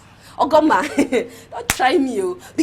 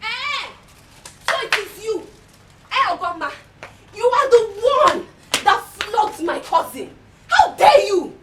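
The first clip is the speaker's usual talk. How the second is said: indignant accusation; 6 semitones higher than usual. Acted. Confronts Ogoma over flogging.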